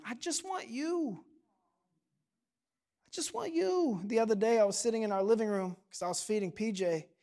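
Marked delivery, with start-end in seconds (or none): 0.1-4.0 put-on very soft, small-child plea; sweet little daughter. Daughter looks up at dad, sweet soft imitation. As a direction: imitate a little girl asking softly. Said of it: "melts my heart when she looks at me and says".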